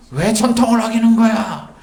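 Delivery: high-pitched mock indignant demand; imitating angry accusers; acted anger. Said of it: "그러면서 이렇게 화를 내는 거죠".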